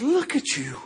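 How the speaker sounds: acted sinister tempter, hushed; whispering devil. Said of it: "comes the devil and begins to whisper".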